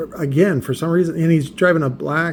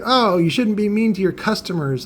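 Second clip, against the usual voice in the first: lightly mocking, raised-pitch imitation; mock scolding; 6 semitones higher than usual. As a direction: imitate a critic's reproach, pitched up. Mimics people scolding him.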